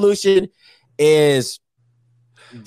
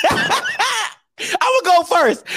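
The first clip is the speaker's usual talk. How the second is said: slow, high, laughing through it; much slower than usual. Real, not acted. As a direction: laugh out the words.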